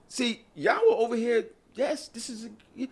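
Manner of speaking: ordinary delivery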